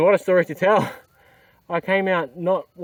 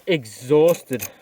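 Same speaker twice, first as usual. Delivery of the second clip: ordinary talk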